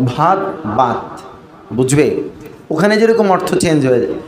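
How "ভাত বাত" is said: very slow, "ভাত" breathy then "বাত" plain; bhat versus bat. Shown as a demonstration. Teacher contrasting aspirated bh with plain b, slow demo. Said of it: "ভকে যদি ব বলেন".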